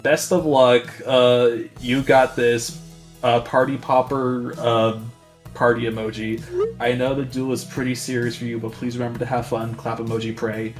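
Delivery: usual speaking voice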